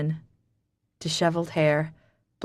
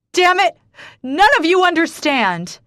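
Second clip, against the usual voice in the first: very loud, high furious yell; screaming outburst; 12 semitones higher, 8 dB louder than usual. Acted. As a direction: rage at all of them. She storms off, screaming.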